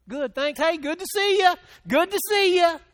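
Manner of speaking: mock the everyone's-fine small talk; fake cheerful greeting. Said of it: "Or do you want me to say".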